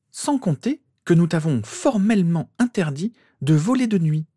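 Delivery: high, emphatic telling-off; scold, leaning on the key words; stern scolding. A narrator's reading. Mother reminding son of the night flying ban, sternly scolding. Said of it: "en foudroyant son fils du regard".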